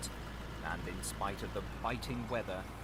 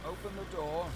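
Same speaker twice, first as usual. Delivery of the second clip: butler; 9 semitones higher than usual. A narrator's reading. Butler commands the servants.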